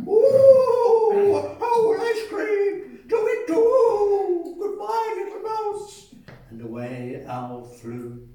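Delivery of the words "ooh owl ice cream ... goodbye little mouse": high, loud hooting owl in a fright; alarmed owl. Acted. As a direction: owl squawking and flapping off. Owl scared off by mouse.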